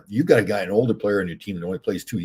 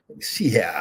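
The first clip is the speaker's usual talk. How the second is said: whispered aside. Real, not acted. Muttering while looking something up.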